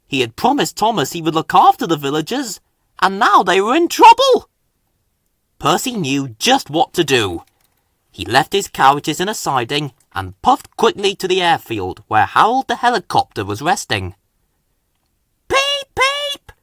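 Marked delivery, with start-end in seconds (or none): 3.0-4.5 worried. A narrator's reading. Narrator voicing Percy's worry. 15.5-16.5 high piping engine whistle; whistled. A narrator's reading. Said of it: "whistled Percy loudly".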